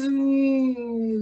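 long steady engine drone; plane engine hum. Acted. Pilot imitating her plane flying in the breeze, steady hum. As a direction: hum a sustained propeller drone.

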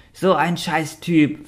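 hoch, langsam und angewidert; angewidert fauchend. A narrator's reading. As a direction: fauchend vor Ekel. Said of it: "fauchte Quacktu angewidert". (angewidertes Fauchen)

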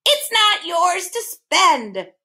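high, slow bird shriek; shrieking bird, high-pitched and drawn out. A narrator's reading. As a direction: screech it out, drawing out the words. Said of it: "the bird shrieked again".

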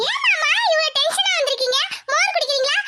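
high, fawning voice with giggles; sugary young woman. Acted. Jothi greeting her cousin Pandi, high and fawning. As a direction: coo at him, high and eager.

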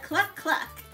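usual speaking voice

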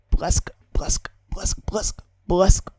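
soft unvoiced splashing noise; whispered splash. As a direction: breathe out each splash. (шепіт плескоту)

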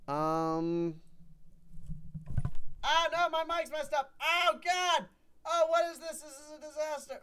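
thin, high wail of distress; high, panicky lament; high-pitched dismay. Real, not acted. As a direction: whine it high as things fall apart. Mic breaks during the game.

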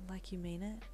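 seductive. A narrator's reading. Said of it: "in a seductive voice".

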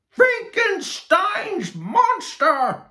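very high, loud, slow yell; cowgirl yell. Acted. Cowgirl yelling at the tall guest, shrieked alarm. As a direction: scream it across the party. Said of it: "yelled a cowgirl".

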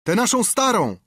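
very high, loud shout; shouted request. A narrator's reading. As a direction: another sailor yells. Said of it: "krzyknął drugi". (okrzyk drugiego marynarza)

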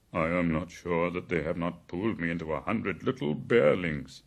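lightly voiced bear, gravely and loudly. A narrator's reading. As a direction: complain gravely, with some force. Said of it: "said Baloo gravely".

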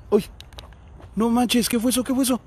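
exclamación sobresaltada, voz más aguda; sobresalto. Real, not acted. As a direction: se asusta de golpe por un ruido. Susto por un ruido en panteón. (susto real en el panteón, preguntando agudo)

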